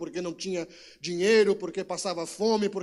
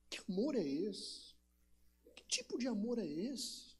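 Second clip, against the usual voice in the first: much softer, almost breathless question; hushed questioning; 11 dB quieter than usual. Acted. Pastor quietly voicing doubt to God. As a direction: let the question fall away quietly.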